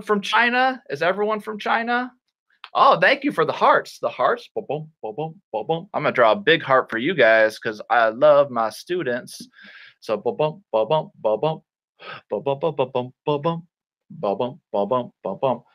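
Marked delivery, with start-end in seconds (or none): 4.5-5.9 gentle rhythmic "ba-boom"; heartbeat imitation. 10.2-15.6 soft rhythmic thumping; low, quick paired thumps; heartbeat imitation. Imitates a heartbeat while drawing.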